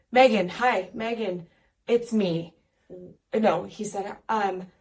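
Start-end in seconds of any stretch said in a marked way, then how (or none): 0.1-2.5 put-on louder, eager little boy; kid on voice text. Boy dictating a text to Megan, eager and loud. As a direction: call her name like a kid on talk-to-text. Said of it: "and he said".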